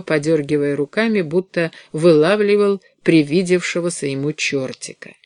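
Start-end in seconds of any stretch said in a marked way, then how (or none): none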